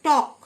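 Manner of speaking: "টক" with the L left unsounded; silent L. Shown as a demonstration. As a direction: model "টক" with no L before the K. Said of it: "এল silent". ("টক", এল উচ্চারণ না করে)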